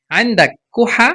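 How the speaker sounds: normal talking voice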